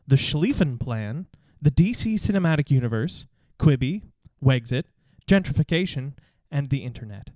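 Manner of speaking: ordinary talk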